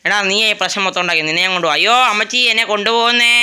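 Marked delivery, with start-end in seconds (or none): 1.8-3.3 loud, high mock panic; panicked wail. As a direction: cry out while being carried off. Being carried off.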